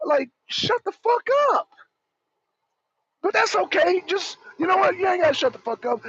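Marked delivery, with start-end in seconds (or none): none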